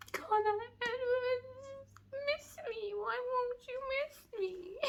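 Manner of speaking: high, slow whiny mock sob; mock wail. Real, not acted. Reader begs a fictional boyfriend, high mock wail. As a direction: pine dramatically like a heartbroken fan. Wailing plea to fictional guy.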